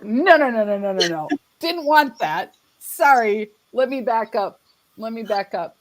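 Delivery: playful flustered backpedalling; mock backpedal. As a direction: hurry to take it back. Mock retreat after coming out.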